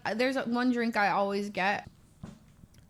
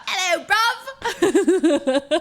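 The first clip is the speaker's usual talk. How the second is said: loud, higher, long drawn-out greeting; imitated exuberant greeting; 6 semitones higher, 5 dB louder, much slower than usual. Reenacting Ellum popping out, exuberant greeting. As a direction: stretch the greeting out, breaking into laughter.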